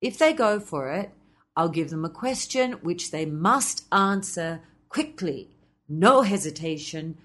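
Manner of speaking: usual speaking voice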